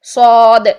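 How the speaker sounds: صاد stretched with aw; slow, thick صاد, mouth full; heavy saad. Shown as a demonstration. Teacher showing students this way, صاد with a long heavy vowel. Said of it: "اس طریقے سے".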